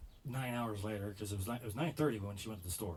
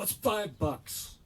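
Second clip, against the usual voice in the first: louder, higher, dragged out; irritated, slow; 10 semitones higher, 7 dB louder, much slower than usual. Retold or imitated. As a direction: spell it out to an idiot slowly. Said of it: "I go".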